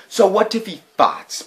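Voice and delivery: put-on high boyish voice for Billy; Billy's voice. A narrator's reading. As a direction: pitch up into a kid's voice. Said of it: "Billy said to Betty".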